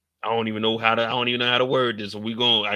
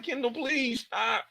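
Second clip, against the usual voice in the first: high, slow whiny plea; mock pleading; 10 semitones higher, slower than usual. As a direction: beg Kendall to stop in a high whine. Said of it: "I'm like".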